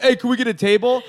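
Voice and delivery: teasing take-charge dude impression; imitating a dude. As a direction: call for a table like a brash guy. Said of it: "you're just, like, a dude".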